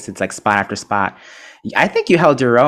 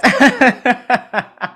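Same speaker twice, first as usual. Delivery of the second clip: burst of laughter; 5 dB louder than usual. Real, not acted. Laughing at the fire alarm.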